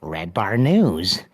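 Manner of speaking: lightly voiced creepy prank caller, slow start; a hint of a sinister phone voice. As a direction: drag out the opening like a creepy caller. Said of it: "Like a r-, creepy voice".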